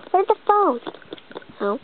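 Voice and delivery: ordinary delivery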